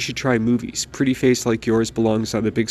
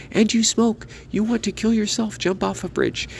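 much higher, outraged mother's shriek; 11 semitones higher than usual. Acted. Mother shrieking at her son for smoking, outraged and much higher. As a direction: screech it at him in disbelief. Mother explodes at his cigarette.

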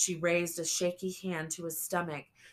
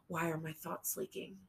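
plain reading voice

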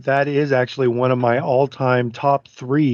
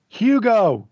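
scream; 9 semitones higher than usual. Real, not acted. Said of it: "didn't mean to scream at you".

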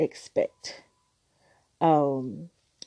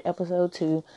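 normal talking voice

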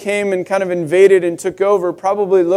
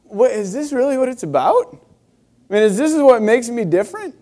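higher-pitched, disbelieving questions with rising ends; incredulous questioning; 6 semitones higher than usual. Retold or imitated. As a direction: act out a baffled "is this it?" reaction. Acts out his disbelieving youthful reaction.

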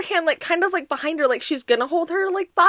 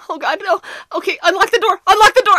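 panicked order; 6 semitones higher, 5 dB louder, faster than usual. Retold or imitated. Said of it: "She's like".

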